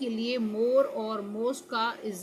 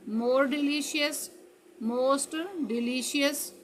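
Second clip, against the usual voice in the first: slow drill; 3 semitones higher, much slower than usual. Shown as a demonstration. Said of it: "slow drill of comparative forms".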